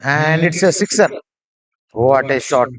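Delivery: loud, high excited call of a six; excited cricket commentary. Real, not acted. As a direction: shout the six with joy. Six hit, commentator shouts.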